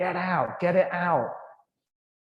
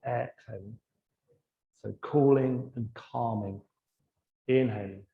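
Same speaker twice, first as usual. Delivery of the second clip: low, soft cues with long silences between; guided breathing meditation; 3 semitones lower, 5 dB quieter than usual. Real, not acted. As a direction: calmly guide each breath, letting the words fade. Leads a breathing exercise.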